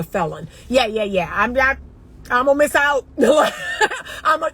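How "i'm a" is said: words breaking into laughter, pitched up; real laughter. Real, not acted. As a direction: laugh right through the words. Laughs at being told she'll miss out.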